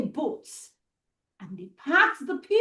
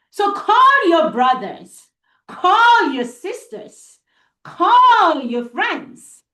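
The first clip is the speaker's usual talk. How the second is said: calling out; 6 semitones higher, 6 dB louder, slower than usual. Real, not acted. Calling children to gather round.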